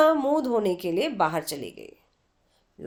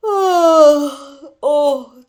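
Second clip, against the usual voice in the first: groan; 3 semitones higher, 11 dB louder, much slower than usual. Acted. Aruna groaning at being woken.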